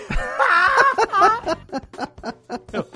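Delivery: real laughter, high and free; hearty burst of laughter. Real, not acted. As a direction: crack up laughing at the blunder. Laughing at the funny blunder.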